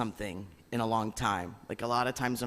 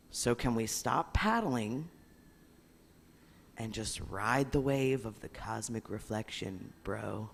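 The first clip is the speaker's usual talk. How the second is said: laid-back surfer dude, softer; 4 semitones lower, 6 dB quieter than usual. Acted. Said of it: "You like my surfer voice in my head?".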